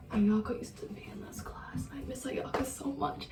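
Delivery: mock teacher whispering softly. Teacher fondly remembering Ayaka, whispered. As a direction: breathe it out as a fond teacher. Teachers voiced missing her.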